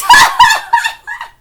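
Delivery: high, loud burst of laughter; squealing laugh. Real, not acted. Fan cracking up at the manga reply, squealing laugh. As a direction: shriek with laughter.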